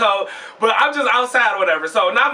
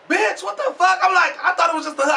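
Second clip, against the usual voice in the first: high, shocked outcry; shocked yell; 8 semitones higher than usual. Retold or imitated. Seeing the whole bumper gone from the car, a high shocked yell. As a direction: yelp at the missing bumper.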